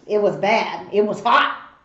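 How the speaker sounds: ordinary talk, nothing marked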